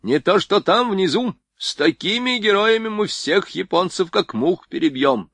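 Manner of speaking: high, loud elated exclaim; delighted. A narrator's reading. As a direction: burst out in delight. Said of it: "пришёл в восторг генерал".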